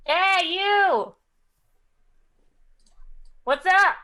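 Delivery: loud, high hail; calling out. Acted. Player hailing the figure over the wind, calling out. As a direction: shout a casual greeting across the gap.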